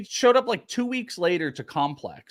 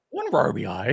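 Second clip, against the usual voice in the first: Scooby-Doo voice; 3 semitones lower than usual. Retold or imitated. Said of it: "You sound like Scooby there".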